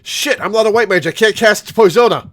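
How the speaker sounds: high, loud panicked exclamation; frustrated outburst. Real, not acted. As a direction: blurt it out in a frantic rush. Panicking at poison mid-fight.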